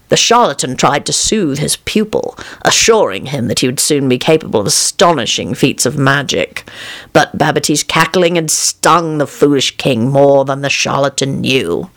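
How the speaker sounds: usual speaking voice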